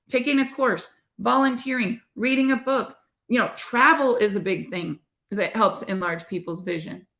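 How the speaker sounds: ordinary delivery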